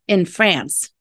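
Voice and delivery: usual speaking voice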